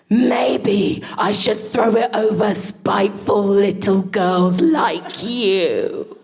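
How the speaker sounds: slow, drawn-out spiteful teacher; shouting at a child. Retold or imitated. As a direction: imitate a teacher venting slowly at a pupil. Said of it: "This guy who shouted at children".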